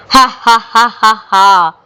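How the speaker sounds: a spirit's mocking cackle; ghostly laugh. Possessing ghost laughing at the husband, eerie cackle. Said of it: "నవ్వుతూ కనిపించింది".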